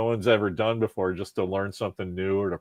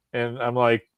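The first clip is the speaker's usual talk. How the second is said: normal talking voice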